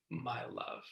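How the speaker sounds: soft, tender whispered endearment; gentle whisper. Retold or imitated. Teacher echoes Pascal's gentle self-talk, in a tender whisper. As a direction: breathe it gently, like cradling yourself. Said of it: "he has this very gentle voice".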